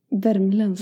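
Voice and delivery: imitated Värmland dialect; Värmland accent. Copying an actress from Solsidan, Värmland accent. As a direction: say it like someone from Värmland. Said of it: "hon pratar ju värmländska".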